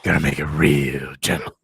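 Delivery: very low, loud mock seducer; hammed-up deep, sultry voice; put-on deep voice. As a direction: croon it deep into the microphone. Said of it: "did that voice".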